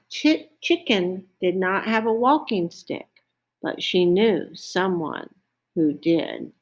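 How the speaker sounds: usual speaking voice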